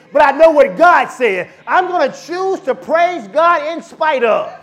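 loud, high, shouted preaching; preaching at full force. Real, not acted. Pastor shouting faith to the congregation, full-force preaching. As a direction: whip the church up into praise.

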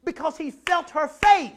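ordinary talk

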